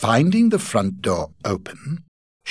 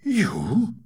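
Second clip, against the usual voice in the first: higher, louder disbelief; astonished Godfrey; 12 semitones higher, 6 dB louder than usual. Acted. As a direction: react in shock to her words. Asked Godfrey.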